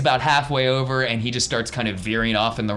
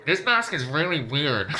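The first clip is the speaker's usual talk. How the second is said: Bob grumbling under his helmet, a touch higher; lightly voiced trooper behind a mask; muffled stormtrooper; 6 semitones higher than usual.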